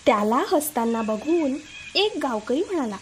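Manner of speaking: plain reading voice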